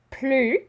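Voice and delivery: "Plus" as plü, S silent, said high and loud; "Plus" with rounded U, no S; French model word. Shown as a demonstration. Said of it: "we don't pronounce that S".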